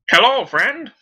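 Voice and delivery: loud, high, sing-song hello; overly friendly greeting. Acted. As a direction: greet him with fake warmth. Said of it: "I'm gonna be like".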